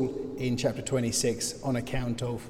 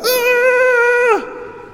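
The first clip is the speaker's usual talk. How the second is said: long, loud, high scream; startled scream; 10 dB louder than usual. Acted. Whole camp woken by Saul's cry, screaming. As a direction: scream like a camp jolted awake. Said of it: "they're gonna go".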